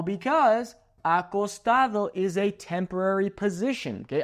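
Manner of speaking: ordinary talk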